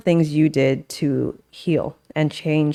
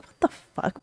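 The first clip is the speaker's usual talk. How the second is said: retold angry mutter; 7 dB quieter than usual. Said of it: "my default is, like, anger first".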